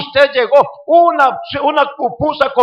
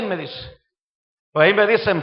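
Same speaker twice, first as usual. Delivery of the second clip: ordinary delivery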